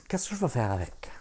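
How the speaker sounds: chuchoté, pensée intérieure; whisper. Acted. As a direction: murmure sans voix, pour soi. Jack pense tout bas. (Jack se parle à lui-même, chuchoté)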